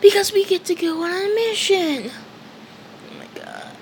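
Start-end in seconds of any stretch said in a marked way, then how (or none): 0.0-2.1 high-pitched eager cry; excited yell. Retold or imitated. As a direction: shout it up high like a thrilled kid. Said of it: "he starts yelling".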